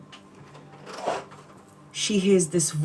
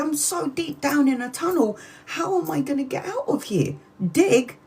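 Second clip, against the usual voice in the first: put-on panicked child; 8 semitones higher than usual. Trapped child despairing in well.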